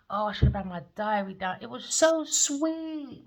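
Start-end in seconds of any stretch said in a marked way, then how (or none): 1.6-3.2 tender. Real, not acted. Moved by the reading.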